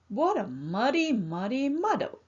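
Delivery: usual speaking voice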